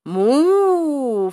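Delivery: dog copying the cow's moo; dog tries moo. Acted. Dog copying the cow, long high moo. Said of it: "fece il cane".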